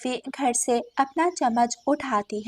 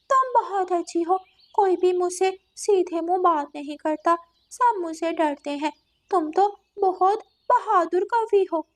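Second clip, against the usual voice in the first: higher-pitched cat, buttering someone up; flattering cat; 7 semitones higher than usual. Acted. Cat praises the female crow, flattering. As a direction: flatter sweetly as the story's cat.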